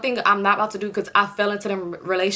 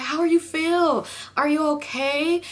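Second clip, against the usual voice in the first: higher, slow, put-on fussing voice; mock concerned little girl; 7 semitones higher, slower than usual. Sisters fussing over her in a high, slow, put-on voice. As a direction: coo it like a fussy kid sister.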